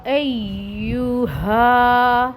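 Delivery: ایھا drawn out very slowly; very slow, chanted ایھا; recited separately. Shown as a demonstration. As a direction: sing ایھا slowly on its own. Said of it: "یہ میں نے آپ کو الگ پڑھ کے دکھایا".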